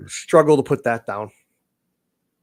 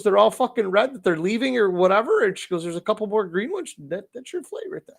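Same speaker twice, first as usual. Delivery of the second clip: bartender voice; 7 semitones higher than usual. Retold or imitated.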